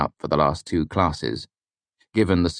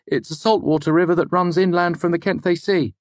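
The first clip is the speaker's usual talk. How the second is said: high-pitched student voice, louder; Fenton; 13 semitones higher, 3 dB louder than usual. Acted. Student giving the lecturer an interesting fact, high voice.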